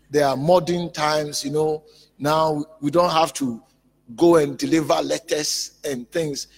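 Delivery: loud, high, insistent sermon delivery; forceful preaching. Acted. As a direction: preach it loud, voicing the doubters' argument.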